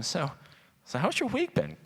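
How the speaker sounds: casual parent opener. Pretend. Mock casual parent question to kid.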